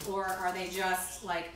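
ordinary talk

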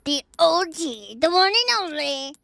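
a whispered build-up that rises to a grand reveal; mock-grand whispered introduction of Mister Ghost; hushed announcer intro. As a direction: whisper it like you're unveiling a legend.

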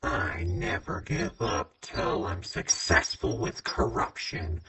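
ordinary talk

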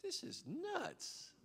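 a hint of a doubter's scoff, slow; lightly voiced skeptic sneering; mock scoffing. Preacher imitating doubters who scoff at him, mocking and slow. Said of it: "people are like".

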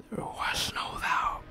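slow, voiceless whisper; ominous whisper. Acted. Streamer ending the horror narration for viewers, ominous whisper. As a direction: whisper it slowly for suspense.

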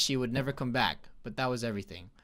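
usual speaking voice

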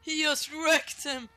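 loud, high excited yell; excited shout. Real, not acted. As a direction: shout in amazement. Watching a big takedown.

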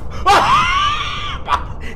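high shrieking laugh. Real, not acted. Rival looks into the camera.

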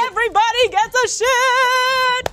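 high, slow, sung Oprah giveaway; Oprah impression. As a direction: belt it out like a talk show host. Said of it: "That's my Oprah".

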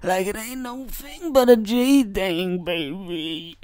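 high, smooth put-on gangster voice, laid back; lightly hammed-up smooth G persona; put-on cool gangster. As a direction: strut through it like a smooth player.

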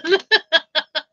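high burst of giggles; giggling laugh. Real, not acted. Streamer amused by bacon money from Jason, giggling laugh. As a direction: giggle helplessly.

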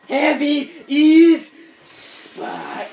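very slow, loud, dramatic announcement; drawn-out announcement. Pretend. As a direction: stretch every word like a big reveal. Repeating game catchphrase.

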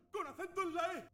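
very high, squeaky cartoon voice; high-pitched riddle voice; squeaky clue voice. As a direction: chirp out the clue like a toy. Game voice gives fruit clue.

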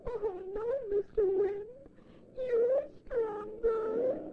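high, slow, tearful little voice; crying a little. Acted. The rain answering the Wind, high and tearful. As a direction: whimper it through tears. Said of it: "crying a little".

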